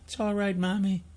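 put-on high, very soft child's voice; tender, tiny high voice; comforting little boy. A narrator's reading. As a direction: soothe mommy as a small boy. Boy comforts crying mother.

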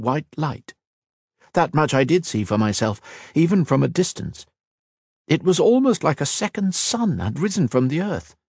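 high, lilting storytelling voice; earnest elf. Acted. Kira recounting Matra's fall to Kat, earnest elf.

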